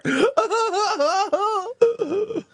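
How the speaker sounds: cackling till it squeaks; high wheezing laugh. Real, not acted. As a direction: laugh and cry at once. Laughing after the bit.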